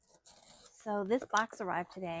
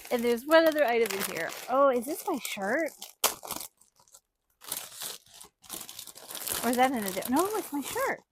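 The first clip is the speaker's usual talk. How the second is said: excited rush; 5 dB louder than usual. Real, not acted. Excited find.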